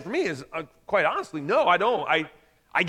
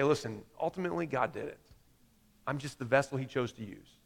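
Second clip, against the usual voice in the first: humble and soft; 7 semitones lower, 11 dB quieter than usual. Retold or imitated. Humble answer to the world.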